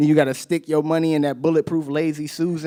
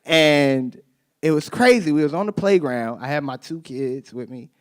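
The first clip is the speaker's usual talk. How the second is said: ordinary talk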